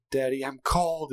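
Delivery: put-on child's complaint; complaining child. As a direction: imitate a little boy complaining to dad. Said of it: "he starts complaining about that".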